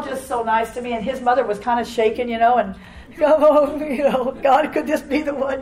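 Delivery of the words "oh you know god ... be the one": anxious prayer. Retold or imitated. Mother-in-law's worried prayer.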